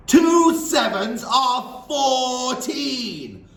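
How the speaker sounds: very slow, high, loud bellow. Acted. Headmistress bellowing the sum at the dangling boy, slow and loud. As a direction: drum the sum into him. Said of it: "Miss Trunchbull bellowed".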